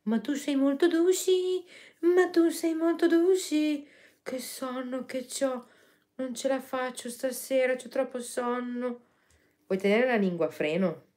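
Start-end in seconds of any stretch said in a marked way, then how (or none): 0.0-3.9 high cooing voice to a dog; baby talk to pet. Acted. 4.2-9.0 sleepy. Pretend. Voicing the sleepy dog.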